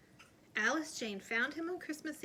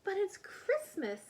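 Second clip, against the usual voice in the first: much higher pleading voice; little girl begging to keep him; pleading child; 10 semitones higher than usual. A narrator's reading.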